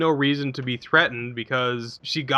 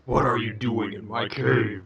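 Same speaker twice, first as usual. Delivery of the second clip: deep gruff dragon; 2 semitones lower than usual. Retold or imitated. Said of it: "deep, gruff, booming sort of voice".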